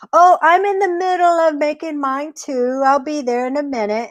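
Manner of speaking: high-pitched storybook rabbit; busy trickster rabbit. Acted.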